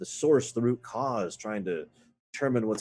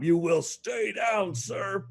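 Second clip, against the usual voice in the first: slow, drawn-out shout, much higher; commanding shout; 10 semitones higher, much slower than usual. Acted. Player shouting at captive to stay down, slow commanding shout. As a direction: bark the order down at him.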